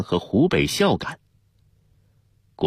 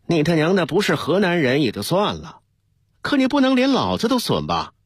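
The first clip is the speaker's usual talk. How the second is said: angry challenge; 10 semitones higher than usual. A narrator's reading. Deputy picks a fight with the new team leader, indignant. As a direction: pick a quarrel, pitch riding high.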